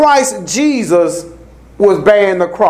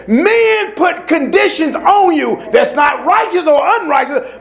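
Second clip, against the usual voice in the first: preaching at full force; 6 semitones higher than usual. Acted. Preacher warning the church about controlling men, full force. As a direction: press the point home from the pulpit.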